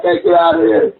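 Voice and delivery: very high imitated female cry; panicked urging. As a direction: voice a woman desperate to flee. Woman's retold panicked shout.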